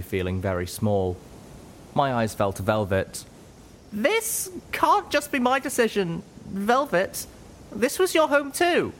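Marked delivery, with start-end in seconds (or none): 3.9-8.9 much higher, slow and begging; high, imploring voice; pleading. Acted. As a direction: plead with your friend. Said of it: "My eyes pleaded with her".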